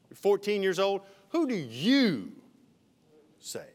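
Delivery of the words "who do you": slow question with a long, stressed "you"; pointed challenge, heavy weight on a drawn-out "you"; emphatic YOU. Shown as a demonstration. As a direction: stretch "you" out and drive it home. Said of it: "It's emphatic".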